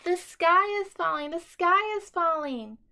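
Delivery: high, loud frantic shrieking; panicked scream. A narrator's reading. Scared chick screaming to everyone as he runs. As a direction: shriek it while running in panic. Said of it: "Henny Penny screamed as they ran".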